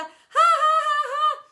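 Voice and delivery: high, loud mocking cackle; howling laughter. Boys laughing at hopping teacher, mocking. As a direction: jeer with wild laughter. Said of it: "howl even louder with laughter".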